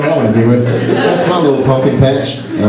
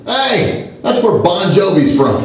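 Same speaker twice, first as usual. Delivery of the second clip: a lightly voiced excited stranger, higher; an eager airport stranger, pitched a little higher; quoting the airport man; 7 semitones higher, slower than usual. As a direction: brighten up suddenly like you found common ground. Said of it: "Japanese gentleman".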